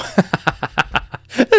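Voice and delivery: high amused laugh; real chuckle at the memory. Real, not acted. Laughs at own story.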